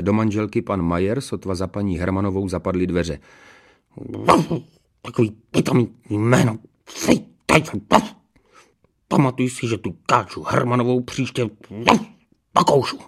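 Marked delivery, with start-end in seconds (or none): 5.0-13.0 angry growl broken by barks; growling dog-man. A narrator's reading. Husband threatening to bite the neighbour, growling.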